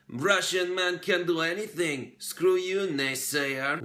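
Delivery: high, loud mock Russian accent. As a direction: bellow it in a thick Russian accent. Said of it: "which is a perfect Russian accent".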